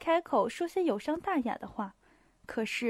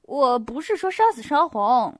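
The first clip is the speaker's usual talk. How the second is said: louder, protesting correction; heated clarification; 7 dB louder than usual. A narrator's reading. Pierre defending his argument, heated. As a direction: protest being misunderstood.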